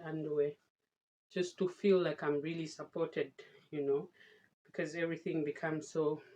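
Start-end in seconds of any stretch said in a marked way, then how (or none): none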